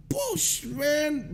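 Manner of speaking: high-pitched outburst of disbelief; indignant. Real, not acted. Reacting to the judge being nice to friend, indignant. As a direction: burst out high, in amused outrage.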